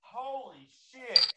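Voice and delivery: slow, high, drawn-out disbelief; stunned. Real, not acted. Reacting to the kid's mean line, slow and stunned. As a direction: let the shock land slowly.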